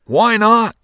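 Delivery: high, loud joyful call; crowd agreeing. A narrator's reading. Townspeople agreeing, high and loud. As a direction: shout approval together. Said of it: "said all the people".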